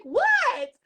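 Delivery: high, loud shocked squeak; a startled, disbelieving yelp; shocked what. Retold or imitated. Shocked reply in retold exchange.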